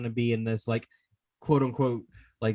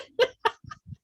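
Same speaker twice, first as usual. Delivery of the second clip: laughing. Real, not acted. Laughing at co-host.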